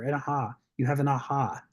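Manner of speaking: ordinary delivery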